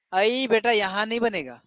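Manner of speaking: ordinary delivery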